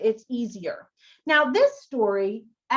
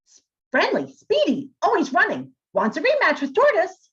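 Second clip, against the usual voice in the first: higher, lively hare voice; speedy hare; 7 semitones higher than usual. Shown as a demonstration. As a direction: bounce through it like a fast rabbit. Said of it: "do all the characters that way".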